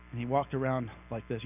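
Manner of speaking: ordinary delivery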